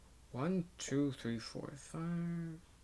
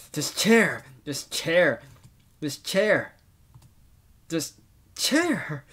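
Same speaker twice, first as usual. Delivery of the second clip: loud raging chant building to drawn-out yells; acted rage, escalating; 4 semitones higher, 7 dB louder, slower than usual. Gamer voices a character gone insane over a chair, escalating rage. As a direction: build from firm repeats to high, loud outbursts.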